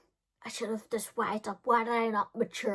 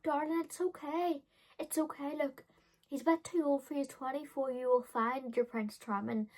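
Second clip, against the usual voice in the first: soft, higher, gentle comforting voice; soothing adult; 5 semitones higher, 4 dB quieter than usual. Retold or imitated. Adult Paula comforts the crying teen, soft and soothing. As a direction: hush her like a kind auntie. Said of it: "she's like".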